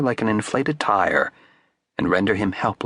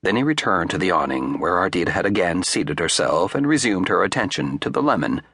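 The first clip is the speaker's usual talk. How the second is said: ordinary delivery, nothing marked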